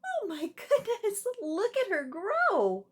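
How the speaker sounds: high, delighted gasp of wonder; amazed laughing exclamation. Real, not acted. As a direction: marvel at her growing. Reader marvels at growing alligator.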